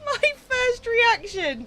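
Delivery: high, giggly apology; squeaky, laugh-choked words. Real, not acted. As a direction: talk through a fit of giggles. Helpless laughing at the Cars theory.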